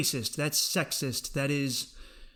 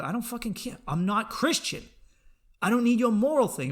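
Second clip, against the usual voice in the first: defiant retort; 8 semitones higher, 4 dB louder than usual. Retold or imitated.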